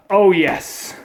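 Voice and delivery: normal talking voice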